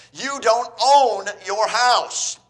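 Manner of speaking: loud, high, slow "You don't own"; emphatic preaching. Real, not acted. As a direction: hammer it home to the congregation. Preacher driving the point home.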